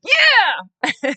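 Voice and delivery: imitated high, loud, long "yeah"; triumphant cheer. Supporter cheering as he signs the petition, triumphant. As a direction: whoop it like a cheering fan.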